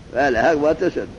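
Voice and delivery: ordinary delivery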